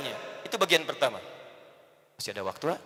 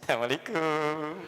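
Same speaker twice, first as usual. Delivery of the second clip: gentle greeting; 5 semitones lower, 6 dB quieter, slower than usual. Acted. Husband greets sulking wife smiling.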